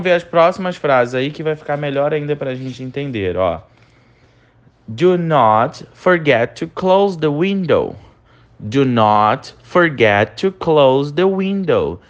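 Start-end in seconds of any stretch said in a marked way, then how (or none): 4.9-12.0 very slow, carefully spaced English for students to repeat; slow pronunciation model. Shown as a demonstration. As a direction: say each word slowly so the class can echo it. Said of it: "Repete a pronúncia comigo". (leitura bem lenta para o aluno repetir)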